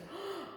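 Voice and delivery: gasp. Pretend. Pirate spots mermaids.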